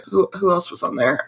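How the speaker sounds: usual speaking voice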